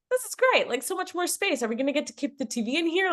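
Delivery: put-on high, loud, thrilled kid chatter; excited kids. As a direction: gush like kids with a big new space. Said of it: "So the kids are like".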